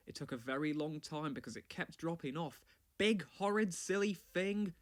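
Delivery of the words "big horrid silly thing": louder, higher grumble, slowing at the end; grumbling. Acted. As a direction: grumble at the plough in loud annoyance. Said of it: "Thomas grumbled".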